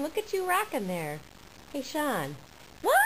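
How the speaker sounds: ordinary delivery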